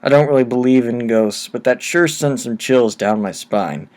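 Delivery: plain reading voice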